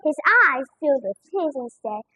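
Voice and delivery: ordinary delivery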